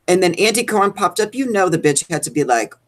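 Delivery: normal talking voice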